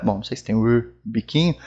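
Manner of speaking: ordinary talk, nothing marked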